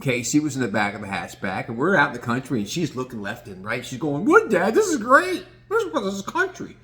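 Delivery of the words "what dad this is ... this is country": high-pitched, thrilled dog talking to dad; mock-excited squeaky pet voice; excited dog's voice. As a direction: play an amazed dog way up high.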